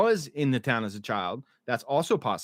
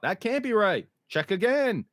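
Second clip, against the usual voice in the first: higher, insistent imitation of a panicked patient; hammed-up alarmed demand, higher; mock freak-out; 8 semitones higher than usual. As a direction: pitch up to act out her disbelief. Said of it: "Fatima freaks out".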